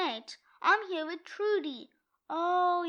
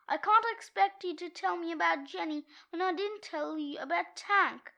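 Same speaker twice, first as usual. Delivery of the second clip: normal talking voice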